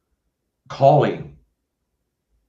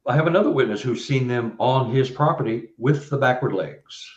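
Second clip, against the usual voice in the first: ordinary delivery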